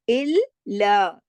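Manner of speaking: इल्ला drawn out slowly, ल held double; doubled lam. Shown as a demonstration. Teacher repeats इल्ला so students hear the double lam. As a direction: linger on the ल of इल्ला. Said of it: "लाम दो दफा है".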